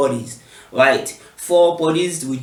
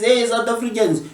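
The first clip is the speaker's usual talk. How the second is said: higher, hammed-up Trump mockery; 7 semitones higher than usual. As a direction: mimic Trump accusing South Africans, pitched up. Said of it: "Trump says".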